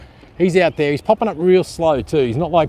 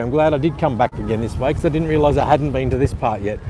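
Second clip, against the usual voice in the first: ordinary talk, nothing marked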